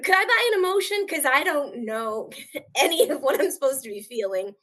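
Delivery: high, fast and loud, breaking into a laugh; a quick, laughing high-pitched cry of confusion; half-laughing, exasperated question; mock bewildered plea.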